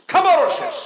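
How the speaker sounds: high, loud punch; emphatic outcry. Real, not acted. Climax of food running out.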